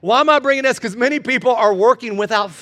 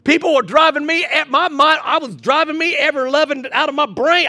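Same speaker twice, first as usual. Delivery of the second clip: ordinary delivery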